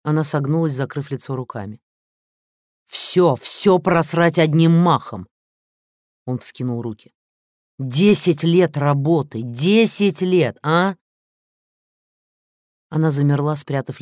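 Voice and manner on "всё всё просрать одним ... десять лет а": loud, high, slow furious yelling; raging shout. Acted. President rages at her over ten wasted years with a loud slow shout. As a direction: hammer each word in rage.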